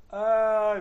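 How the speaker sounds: bright burst of laughter; laughing. Real, not acted. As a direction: laugh and sigh. Laughs at the memory.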